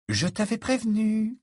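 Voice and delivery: high, knowing reproach; curt I-told-you-so. A narrator's reading. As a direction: remark smugly to a friend. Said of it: "commenta brièvement Hermione".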